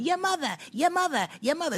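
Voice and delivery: high-pitched chanted taunt; mocking imitation. Mimics the woman's repeated taunt.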